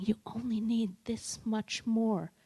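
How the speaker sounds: slow, whispered emphasis; hushed emphasis. Shown as a demonstration. Speaker shows an audience a tiny amount, hushed and slow. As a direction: draw it out in a near whisper. Shows small gesture with matching voice.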